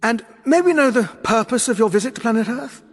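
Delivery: high, loud, bright questioning voice; inquiring Doctor. Acted. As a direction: pipe up with a probing question. Doctor politely questions the invaders.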